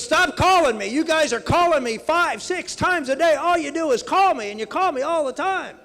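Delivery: put-on high, loud old lady ranting; angry old woman. Old woman scolds the candidate over phone calls, high loud rant. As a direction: rant it like a fed-up grandma. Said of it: "She goes".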